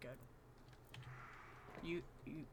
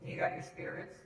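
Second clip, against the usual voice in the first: whispery, distorted spirit filter; spooky ghost voice through a filter; evil voice changer; 5 semitones higher, 4 dB quieter than usual. Acted. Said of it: "It sounds evil".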